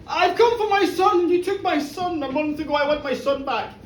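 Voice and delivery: insistent demand. Acted. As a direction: demand your child back from his captor. Father confronts his son's captor.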